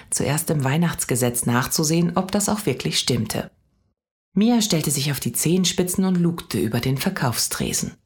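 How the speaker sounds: ordinary delivery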